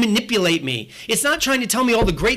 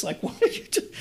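breathy laughing disbelief, much higher; incredulous laugh; 12 semitones higher, 6 dB quieter than usual. Retold or imitated. Imagined car buyer laughing at a too honest salesman.

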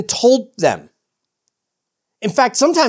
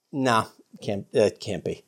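low, soft doubting murmur; low, hushed mock disbelief; muted dismissal; 9 semitones lower, 9 dB quieter, slower than usual. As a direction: brush it off quietly, imitating disbelief. Voicing disciples dismissing the idea.

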